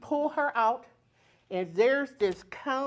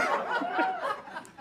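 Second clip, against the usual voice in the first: a burst of very high laughter; 5 dB quieter than usual. Real, not acted. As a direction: let out a squealing laugh. Laughing at her own panic.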